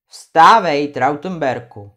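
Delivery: loud, low call of the mountain spirit; stern command. Acted. Krakonoš orders Trautenberg to get up, loud and stern. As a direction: order him up like thunder.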